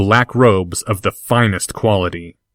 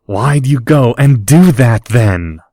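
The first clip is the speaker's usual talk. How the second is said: frustrated; 2 semitones higher, 5 dB louder than usual. Acted. Draco's frustrated outburst.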